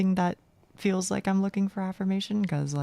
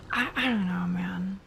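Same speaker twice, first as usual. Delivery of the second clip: whispering. Real, not acted. Muttered aside about own voice.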